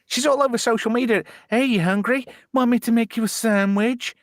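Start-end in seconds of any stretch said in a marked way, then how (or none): 1.5-4.1 high-pitched mock come-on; imitated flirty invitation. Streamer imitating sandwich woman offering viewers food, high mock invitation. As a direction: imitate an online temptress, voice pitched up.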